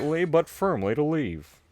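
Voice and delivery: lightly voiced Southern dad teaching his son; a quoted Texan cartoon dad voice; Hank Hill. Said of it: "some of the only quotes I know".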